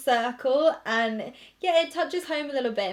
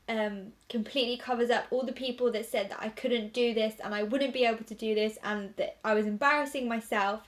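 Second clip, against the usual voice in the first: normal talking voice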